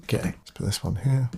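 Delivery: whispered aside to himself; whispered working mutter. Real, not acted. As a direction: breathe it out as a private note. Working alone in Photoshop.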